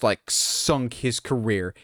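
usual speaking voice

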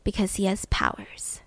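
hushed aside; half-whispered remark. Real, not acted. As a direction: drop to a whisper.

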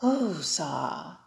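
slow breathy calming exhale, Whoo-sa; Whoo-sa exhaled low and drawn out; calming breath. Shown as a demonstration. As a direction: breathe out Whoo-sa slowly and soft. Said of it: "take a deep breath in and take a deep breath out".

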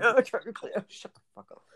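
high burst dropping to a voiceless laugh; helpless with laughter, squeezing the words out; laughing protest. Real, not acted. Laughing off a friend's silly technicality, breathless with laughter.

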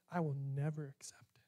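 a quick, breathy whisper, almost hidden; whispered aside. Retold or imitated. Preacher whispers to the congregation, quick hushed whisper. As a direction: whisper it like a line God never said. God's words he never said.

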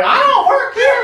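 high, loud, slow mock yell while running off. Fleeing homeless man yells at comedian, hammed-up high yell. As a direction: yell it back over your shoulder, hammed-up.

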